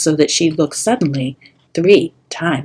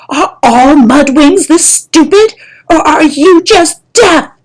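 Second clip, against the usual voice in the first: bellowing; 10 semitones higher, 10 dB louder than usual. Acted. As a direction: yell the insult right in his ear. Kestrel keeps bellowing insults.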